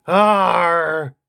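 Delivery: loud creature grunt of Arr. Acted. Agnew the creature replies to host with loud Arr. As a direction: Agnew grunts his point. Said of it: "Yeah, I know".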